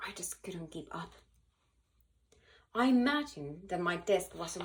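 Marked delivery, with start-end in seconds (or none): 0.1-1.1 breathy defeated whisper. A narrator's reading. Cookie admits falling behind in class, in a whisper. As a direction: whisper it like you're giving up.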